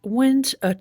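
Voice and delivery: lightly hammed-up Mary Poppins impression. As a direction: drawl it out like a magical nanny. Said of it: "Mary Poppins?".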